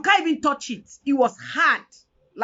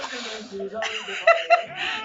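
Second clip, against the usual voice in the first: laugh. Real, not acted. Laughs at the quoted saying.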